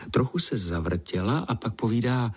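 usual speaking voice

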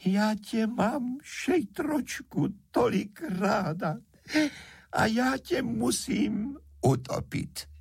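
very high, slow tearful wail; acted sobbing sprite, drawn out; weeping fairy. A narrator's reading. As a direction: cry it out in a tiny high voice. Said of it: "rozplakala nejusedavěji".

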